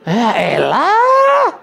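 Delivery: exasperated groan. Acted. Child exasperated at father.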